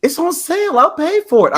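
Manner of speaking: high-pitched imitation of a nagging grandma; put-on high grandma voice; nagging grandmother. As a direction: mimic grandma pushing, voice lifted high. Voicing grandmother's sales pitch.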